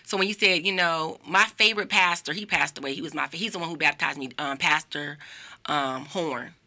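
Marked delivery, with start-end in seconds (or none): none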